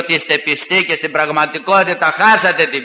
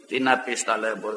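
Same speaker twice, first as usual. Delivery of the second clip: quiet pitying remark; 6 semitones lower, 9 dB quieter than usual. Retold or imitated. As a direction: lower and softer, pitying. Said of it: "του λέω".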